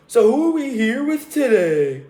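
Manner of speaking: high, loud mock interviewer voice. Podcaster mimicking a rival interviewer's dumb question, high mocking voice.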